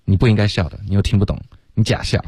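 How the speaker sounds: ordinary talk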